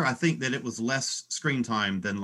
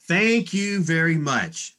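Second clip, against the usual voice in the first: "Thank you very much" drawn out, higher; emphatic agreement; 7 semitones higher, 4 dB louder, slower than usual. Real, not acted. Backing co-host's wish that Rin lived, "Thank you very much" slow and emphatic. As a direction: stretch "Thank you" slowly at a raised pitch.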